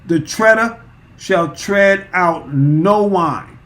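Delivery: drawn-out, solemn reading of the verse; measured pulpit reading; slow scripture reading. Acted. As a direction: lay each word down slowly like holy text.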